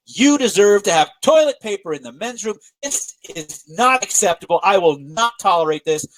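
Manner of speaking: outrage him back on his behalf; indignant clerk siding with the angry customer; angry outburst. Retold or imitated. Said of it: "He gets angry".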